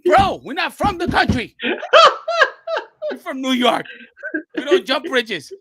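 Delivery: high, loud mock outrage; incredulous protest. Brooklyn guy refusing a country-style bridge jump, incredulous retold protest.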